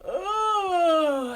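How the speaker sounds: long, high groan sliding down; stretching groan. Acted. Dingo stretches all the way down, groaning. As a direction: let out a big stretchy moan. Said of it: "and all the way down".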